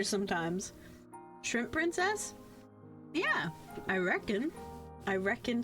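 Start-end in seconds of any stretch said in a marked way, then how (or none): none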